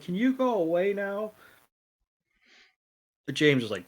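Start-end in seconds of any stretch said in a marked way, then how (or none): 0.0-1.3 higher, put-on whiny voice; a mocking, higher-pitched brush-off; Ash's fed-up voice. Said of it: "Ash is like".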